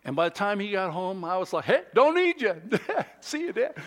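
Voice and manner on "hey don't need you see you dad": high, loud, joking dismissal with a laugh; laughing mock brush-off. Preacher jokes he told his dad he wasn't needed, laughing. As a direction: toss it off laughing.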